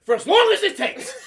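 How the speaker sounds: high, loud furious retort; mom yelling. Retold or imitated. Mom answering how long he's grounded, yelling. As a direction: bellow it back up high.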